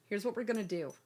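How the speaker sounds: usual speaking voice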